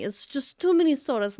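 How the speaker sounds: playful self-reproach about the sodas; mock self-scolding. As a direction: tell yourself off in jest. Scolding herself over soda.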